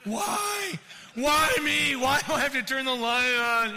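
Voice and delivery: loud, high wail of complaint; hammed-up shouted whine of a put-upon husband; whining protest. Husband asked to turn the light on, wailing protest. Long-married husband balks at request.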